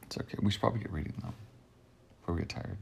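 soft unvoiced whisper. Real, not acted. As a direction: whisper the suggestion quietly. Quiet aside before reading.

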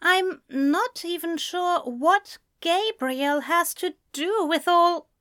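cautious high-voiced protest; careful, confused friend. A narrator's reading.

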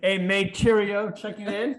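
high-pitched, hammed-up London accent; mock London accent. Co-host playing along with the teasing, mock London accent. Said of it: "Like some London?".

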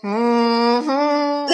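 humming with nose held; pinching nose. Real, not acted. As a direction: hum while holding your nose. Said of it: "I'm just doing it".

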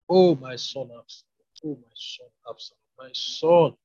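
slow lament, understated weeping. A narrator's reading. King mourning his son Absalom, mildly acted weeping. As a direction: draw out the grieving cry, without real sobs. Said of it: "kept on weeping".

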